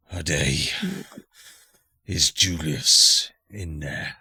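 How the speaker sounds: slow, low menacing stranger; french chef. Acted. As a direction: loom over them, slow and low. GM voices gold visitor Pierre.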